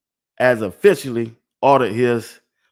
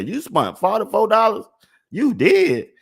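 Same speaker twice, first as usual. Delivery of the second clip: put-on higher-pitched incredulous girlfriend; mock girlfriend voice; 10 semitones higher than usual. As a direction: play the girlfriend in disbelief over the price. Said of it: "she gonna say".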